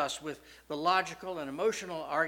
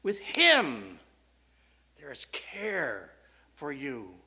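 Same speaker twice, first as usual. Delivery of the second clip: with him loud, then care for you slow and hushed; emphatic; 3 semitones lower, 4 dB louder, slower than usual. Retold or imitated. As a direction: press each word home, then drop low. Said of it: "and it's emphatic".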